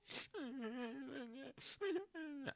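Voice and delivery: high, wailing fake sob; a hammed-up sob; mock crying wail. Acting out how he broke into tears in the office, with a mock wail. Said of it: "I just start crying".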